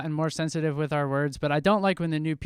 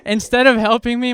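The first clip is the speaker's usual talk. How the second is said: ordinary talk, nothing marked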